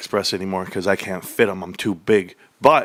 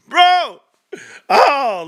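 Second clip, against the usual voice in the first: high, loud exasperated yell; exasperated bro; 6 dB louder than usual. Real, not acted. Streamer exasperated by haters, yelling high and loud. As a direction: throw up your hands and shout it.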